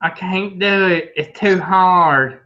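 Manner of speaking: high, slow, whining; whiny complaining voice. Retold or imitated. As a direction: whine like a quitter giving up. Mocking what most people say.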